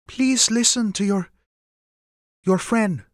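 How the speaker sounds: high mother's voice, hesitating over a word; awkward, pleading mother. Acted. Mother glancing away from the men's hands, awkward.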